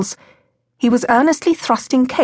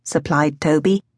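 ordinary delivery

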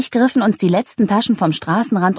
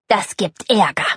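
tense warning, mildly hissed; understated menacing hiss; hissed threat; 2 semitones higher than usual. A narrator's reading. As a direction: threaten trouble through a slight hiss. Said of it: "zischte Usch".

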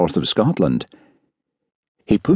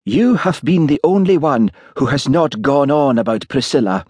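put-on upset Highland accent, higher pitch; sibilant Highland accent; 7 semitones higher than usual. A narrator's reading. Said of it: "his Highland accent becoming more sibilant".

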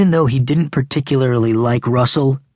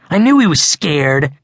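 high-pitched triumphant gloat; crowing; 4 semitones higher, 3 dB louder than usual. A narrator's reading. Boy crowing that his rival is afraid. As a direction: gloat at being proved right. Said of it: "Hayden crowed".

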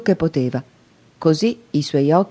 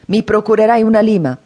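higher, louder convict threat; angry threat; 5 semitones higher, 5 dB louder than usual. A narrator's reading. Convict shaking the boy, demanding a file, angrily louder. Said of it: "mi scuoteva rabbiosamente".

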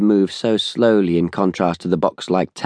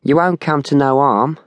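higher-pitched mocking youth; mock reassurance, pitched up; 7 semitones higher than usual. A narrator's reading. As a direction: taunt her in an Americanized Cockney voice. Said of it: "he mocked her".

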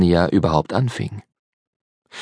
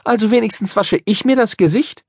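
put-on high, pert girl's voice; prim retort; 12 semitones higher than usual. A narrator's reading. Polly needles the grubby boy, high and pert. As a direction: score a point, a bit smug. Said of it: "sagte Polly".

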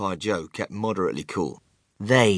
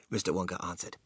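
usual speaking voice

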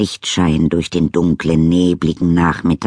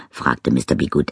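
ordinary delivery

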